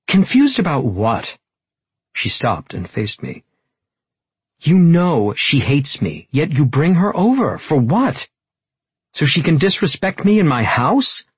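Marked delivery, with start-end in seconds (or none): 0.1-1.4 higher, louder irritated question; irritated challenge. Acted. Tessa confronting the man following her, irritated. 4.6-11.3 high, loud, indignant accusation; angry confrontation. Acted. Tessa confronting him for bringing her rival over, indignant.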